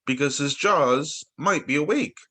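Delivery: sing-song rhyming read-aloud; poem reading. Acted. Reading a rhyme to a child at story time, sing-song.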